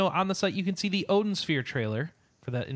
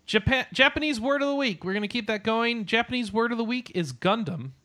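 announcer; 8 semitones higher than usual. Acted. Announcing a podcast segment.

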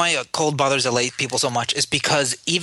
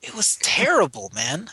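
mock complaint; 6 semitones higher, slower than usual. Mock complaint about cold.

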